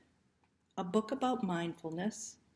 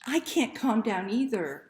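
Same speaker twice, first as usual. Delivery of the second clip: usual speaking voice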